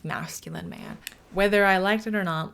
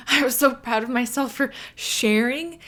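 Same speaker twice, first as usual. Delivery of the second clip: laughing pride; 4 semitones higher, 5 dB louder than usual. Real, not acted. Proudly recalling sharing her desire.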